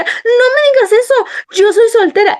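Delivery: put-on high, loud, whiny teenage retort; laughing through a sassy protest; bratty teen. Retelling teen self arguing with dad.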